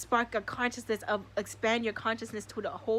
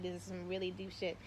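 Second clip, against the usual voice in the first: ordinary delivery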